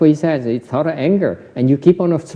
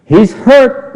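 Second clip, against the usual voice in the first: ordinary talk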